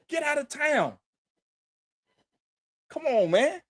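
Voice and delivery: high-pitched, incredulous dismissal; scoffing disbelief. Real, not acted. Host listing Ravens stars against the luck claim, scoffing disbelief. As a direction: toss out each name high, as if it's absurd. Mocking idea Ravens were just lucky.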